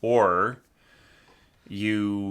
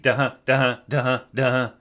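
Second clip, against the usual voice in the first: "da-huh" chanted over and over, louder and higher; accented ties at speed; 4 semitones higher, 5 dB louder than usual. Shown as a demonstration. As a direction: pulse each "huh" in a repeating raised-pitch rhythm. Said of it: "make sense at that speed".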